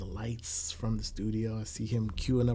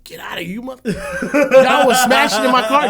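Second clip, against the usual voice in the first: loud laughing disbelief; 13 semitones higher, 15 dB louder than usual. Retold or imitated. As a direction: laugh it out in shock. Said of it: "I'm like".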